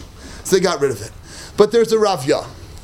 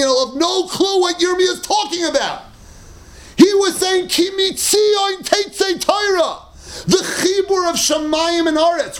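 preaching at full force; 8 semitones higher than usual. Acted. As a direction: cry it out to the whole shul. Climax of sermon.

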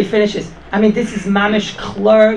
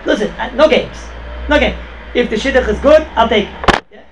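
raised pitch and volume, insistent; retold blunt ultimatum; 5 semitones higher, 4 dB louder than usual. Servant telling the family yes or no, insistent retold ultimatum. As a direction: lay down terms firmly, loud and high.

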